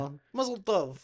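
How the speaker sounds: lightly put-on Russian accent; a quick, half-hearted Russian accent. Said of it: "That was my attempt at doing a Russian accent".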